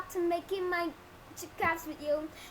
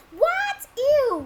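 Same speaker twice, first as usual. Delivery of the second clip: high, loud shriek of disbelief; shocked outburst; 11 semitones higher, 12 dB louder than usual. Retold or imitated. Storyteller replaying their disgust at the spat-on item, shocked outburst.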